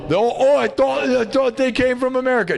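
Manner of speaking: high, clueless, hammed-up voice; mock dumb person. Mocking the people who think ACDC is American, in a high clueless voice. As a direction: play the oblivious fool, way up high. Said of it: "How many dumb people".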